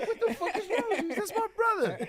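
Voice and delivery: high, loud indignant yell; hammed-up indignation; mock outrage. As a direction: shout it like an offended brother. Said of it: "he said".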